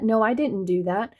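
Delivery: usual speaking voice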